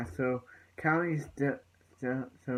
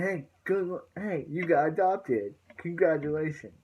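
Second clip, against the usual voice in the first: louder, higher acted-out congratulations; excited congratulations; 4 semitones higher, 4 dB louder than usual. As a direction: imitate someone cheering good news. Retelling Raphael's congratulations on adoption.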